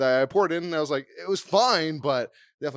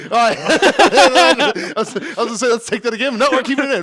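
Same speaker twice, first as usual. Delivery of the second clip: laughing; 8 semitones higher, 11 dB louder than usual. Real, not acted. Laughing about redoing the take.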